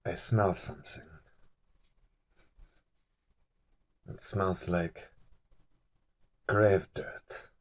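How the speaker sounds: very soft, slow, low sinister murmur; menacing lady. Acted. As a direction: let the threat creep out slowly and softly. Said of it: "stops and goes".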